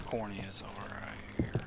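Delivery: hushed whisper. Real, not acted. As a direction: whisper it to yourself. Quiet aside to self.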